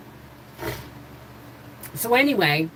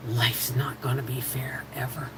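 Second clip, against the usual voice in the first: whispered and softer, a resigned truth; father's whisper; 4 semitones lower, 6 dB quieter than usual. Retold or imitated. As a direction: whisper it like a tired dad to his daughter. Father's quiet answer in retold story.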